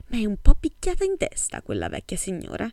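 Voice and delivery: ordinary delivery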